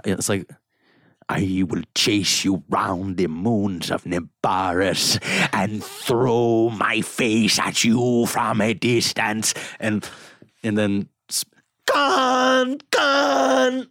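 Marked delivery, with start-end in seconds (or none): 1.3-9.5 put-on very slow, stretched theatrical villain accent; Khan impression. Villain vowing to chase Kirk, slow Khan impression. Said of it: "talking to fucking Khan". 11.9-13.9 high, drawn-out hammy yell; Captain Kirk impression. Captain bellowing his enemy's name, over-the-top Kirk yell. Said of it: "Captain Kirk talking to fucking Khan".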